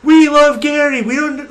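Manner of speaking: high excited fan cry; cheering fans. Acted. Crowd members cheering for Gary, high and excited. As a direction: cheer him on like adoring fans. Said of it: "some people are saying".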